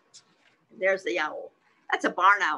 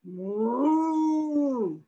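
long drawn-out moo; higher-pitched, sustained cow call; 7 semitones higher, 4 dB louder than usual. Acted. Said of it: "A cow says".

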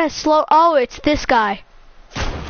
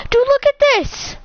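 high-pitched excited exclamation; excited calling out; 10 semitones higher than usual. Real, not acted. Gamer showing a friend the deer, excited. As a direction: urge them to look, pitched way up.

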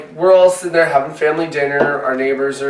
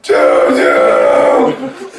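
train call; 4 dB louder than usual. Retold or imitated. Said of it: "all of a sudden goes".

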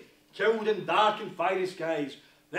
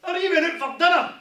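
normal talking voice

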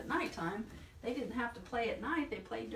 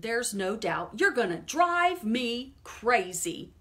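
exasperated shout; 3 semitones higher, 8 dB louder, slower than usual. Acted. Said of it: "Farmer shouted".

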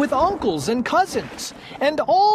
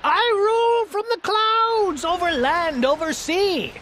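high, imperious proclamation; howled boast; 9 semitones higher than usual. A narrator's reading. Highest turtle declaring his rule over land and sea, howling boast. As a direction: lord it over everyone below. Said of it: "howled the mighty King Yertle".

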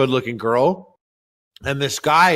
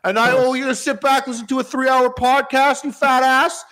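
mock rant; 7 semitones higher than usual. Ranting at fat listeners.

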